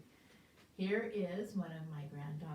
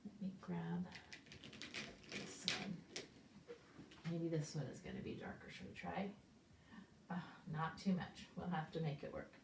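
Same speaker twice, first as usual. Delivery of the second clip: low, softer muttered aside; quiet aside; 3 semitones lower, 11 dB quieter than usual. Real, not acted. Teacher mutters while swapping markers. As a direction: say it to yourself while fetching a marker.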